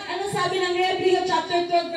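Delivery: ordinary talk, nothing marked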